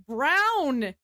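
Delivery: loud, drawn-out exaltation; dramatic proclamation. Acted. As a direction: announce it like a grand reveal. Hyping the Brown song.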